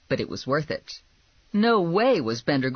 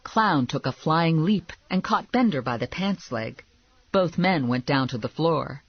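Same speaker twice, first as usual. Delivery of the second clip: ordinary delivery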